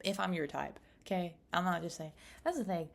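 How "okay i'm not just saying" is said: ordinary talk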